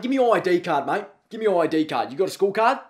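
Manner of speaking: ordinary talk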